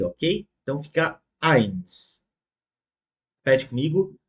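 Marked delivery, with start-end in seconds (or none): 1.4-2.2 "eins" with a clear A-I glide. Shown as a demonstration. Teacher correcting the mistake, "eins" pronounced with A-I. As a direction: say "eins" opening on A, closing on I. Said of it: "vai falar com som de A I".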